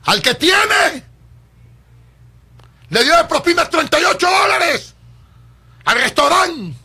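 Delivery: furious shout at the waste; indignant shout. Real, not acted. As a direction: yell it in disbelief. Outraged at the rich man's tip.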